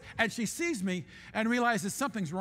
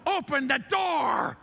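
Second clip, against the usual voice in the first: slow, loud mock yell; hammed-up yell at the door; impatient shout at the plane door; 4 semitones higher, 4 dB louder, slower than usual.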